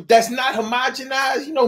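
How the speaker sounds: usual speaking voice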